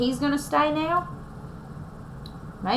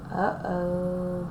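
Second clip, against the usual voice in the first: gasp; 4 semitones lower, 5 dB quieter than usual. Acted. Bear about to sneeze.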